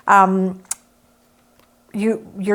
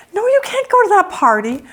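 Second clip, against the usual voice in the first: mock parent; 10 semitones higher than usual. Parent voice mocked.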